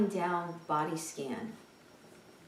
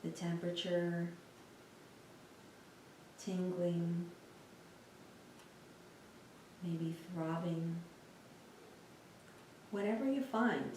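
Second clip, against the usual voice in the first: soft, unhurried meditation voice with long pauses; guided meditation; 2 semitones lower, 10 dB quieter than usual. Real, not acted. Teacher guiding listeners through a lying-down body scan, calm meditation voice. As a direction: guide them gently through a body scan, leaving space between phrases.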